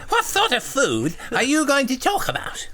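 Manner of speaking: very high, loud silly voice; hammed-up high lisping simpleton; full-on childlike John. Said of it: "John says".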